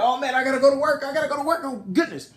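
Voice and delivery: high, fast, flustered outburst; acted-out frantic rush; mock waking panic. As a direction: blurt it like you overslept. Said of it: "he wakes up like".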